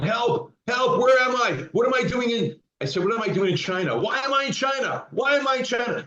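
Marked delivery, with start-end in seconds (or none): 0.0-2.6 much higher, urgent mock yell for help; reliving waking up lost and calling out; patient reenacting his post-surgery panic, high and frantic; retold panicked yell. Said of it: "I just started yelling". 3.0-6.0 much higher, frantic mock screaming; patient waking after surgery, screaming in high mock panic; retold frantic scream. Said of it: "I kept screaming".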